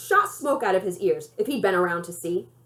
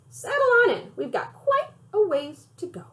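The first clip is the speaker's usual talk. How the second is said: cheery grandma; 10 semitones higher than usual. Acted. Grandma starting the road trip.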